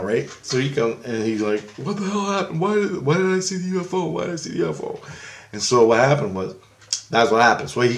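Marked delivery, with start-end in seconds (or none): 1.8-5.0 high-pitched mock panic; messed-up friend. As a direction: imitate a rattled friend demanding answers. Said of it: "he's all messed up now".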